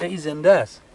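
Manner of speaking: lightly imitated Isfahani accent. Soldier telling his comrade about the wounded man, in a light Isfahani accent. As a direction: quote him with a touch of Isfahani accent. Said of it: "به لهجهٔ اصفهانی گفت".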